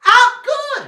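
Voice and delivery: usual speaking voice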